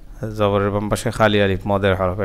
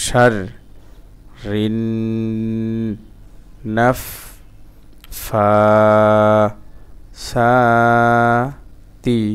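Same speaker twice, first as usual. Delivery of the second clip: very slow, each syllable held apart; much slower than usual. Shown as a demonstration. Teacher repeating for learners, syllable by syllable. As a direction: pause after every stretched syllable.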